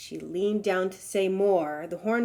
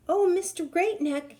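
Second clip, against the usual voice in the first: calling out; 10 semitones higher, 4 dB louder than usual. Acted. Hornbill calling to the passing giraffe, high. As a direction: call him over sweetly and high. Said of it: "she called".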